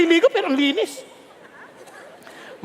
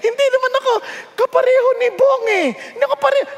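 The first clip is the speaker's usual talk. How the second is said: high-pitched, laughing objection; comic protest; 8 semitones higher, faster than usual. Acted. Preacher telling God he is not like Bong, comic protest. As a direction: beg off in a raised, whiny plea.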